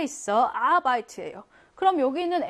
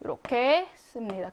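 ordinary talk, nothing marked

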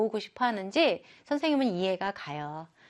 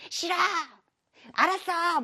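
much higher, defiant outburst; 8 semitones higher than usual. Acted. Daughter shouting back defiantly at her mom. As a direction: fire it back like a stubborn kid. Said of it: "뭐 이렇게 할 텐데".

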